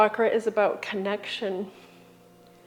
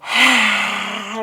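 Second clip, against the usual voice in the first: lion's breath exhale. Shown as a demonstration. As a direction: sigh it out hard through the mouth. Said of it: "open the mouth, stick out your tongue, and sigh it out".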